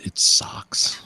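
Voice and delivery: deadpan whisper. Acted. Friend's deadpan verdict on the movie, whispered.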